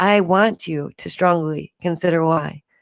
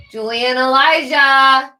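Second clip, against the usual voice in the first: higher and louder, a slow call across the house; 7 semitones higher, 5 dB louder, slower than usual. Real, not acted. Mother calling her raging son by name, loud and drawn out. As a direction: call your son's name to stop him.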